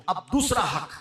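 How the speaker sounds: usual speaking voice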